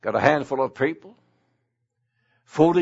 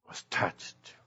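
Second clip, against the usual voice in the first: soft, voiceless, moved hush; hushed awe; 12 dB quieter than usual. Real, not acted. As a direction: drop to a reverent whisper. Preacher hushes at moving moment.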